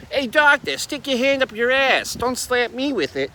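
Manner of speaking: high, hammed-up Boston accent; mock newborn sassing the doctor in broad Boston; Boston-accented baby. Newborn baby telling off the doctor, mock Boston accent. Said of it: "the baby doesn't come out and say".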